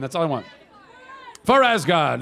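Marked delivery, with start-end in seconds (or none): 1.4-2.2 loud warrior battle cry; viking battle cry. Shown as a demonstration. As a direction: shout it like a viking. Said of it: "the only one who got to say that".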